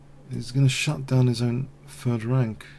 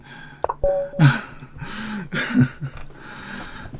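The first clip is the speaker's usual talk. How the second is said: silent wheeze laugh; 8 semitones higher than usual. Real, not acted.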